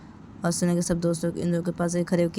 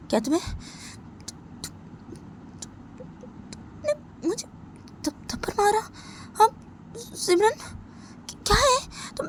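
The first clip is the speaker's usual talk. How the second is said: much higher, crying and stammering; tearful shock. Acted. Slapped Sunaina asking Simran why, crying and stammering. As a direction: choke out the words through tears.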